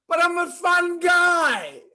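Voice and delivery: high, loud and slow; punchline delivery. Acted. Mushroom pleading with the barman, loud and slow. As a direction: hit the pun big.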